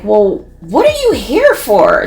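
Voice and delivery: slow, drawn-out roommate voice; roommate's tone. Retold or imitated. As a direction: copy the roommate word for word, slowly. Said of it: "She said it just like that".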